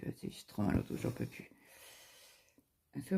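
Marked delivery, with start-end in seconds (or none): 0.1-1.5 whispered, worn out by back pain. Retold or imitated. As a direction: breathe it out, hurting too much to voice it. Retelling back pain in labour.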